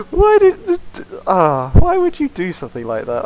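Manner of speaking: laughing disbelief. Real, not acted. Mocking enemy after laughter.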